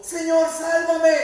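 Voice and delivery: high, loud desperate cry for help; shouted plea. Acted. Pedro shouting to his Lord as he sinks, desperate cry. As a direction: cry out to be saved. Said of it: "Entonces gritó".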